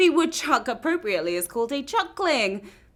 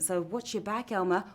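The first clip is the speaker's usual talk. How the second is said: mock hushed warning; 3 semitones lower, 8 dB quieter than usual.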